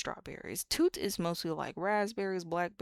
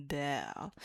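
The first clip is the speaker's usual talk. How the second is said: ordinary talk, nothing marked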